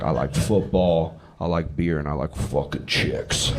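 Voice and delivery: put-on low, slow American bro accent; American guy. Comic mimics his American drinking buddy, mock American accent. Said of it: "He is American".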